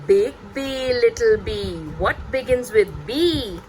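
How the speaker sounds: playful picture-book voice, slowing on the last line; animated read-aloud. Shown as a demonstration. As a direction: draw the little one into the rhyme. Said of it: "I really animate my voice and I read it like".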